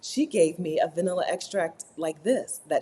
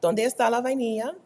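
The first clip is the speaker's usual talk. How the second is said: asking in Spanish; 6 semitones higher than usual. Retold or imitated. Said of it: "When I s-, asked for it".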